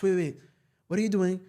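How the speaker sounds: ordinary talk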